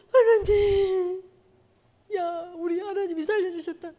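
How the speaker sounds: slow, high voice breaking with tears; choked-up, awed gratitude; tearful relief. Retold or imitated. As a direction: thank God through sobs. Retold relief after the explosion.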